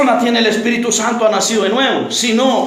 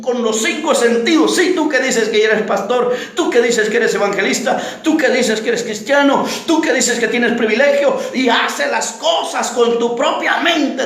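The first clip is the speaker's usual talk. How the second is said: usual speaking voice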